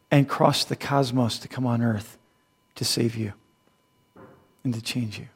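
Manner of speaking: hushed, heartfelt preaching; quiet preaching. Acted.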